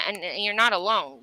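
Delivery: normal talking voice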